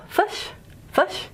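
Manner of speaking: "fish" with a short schwa, like "fush"; New Zealand vowel. Shown as a demonstration. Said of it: "I know they can in New Zealand".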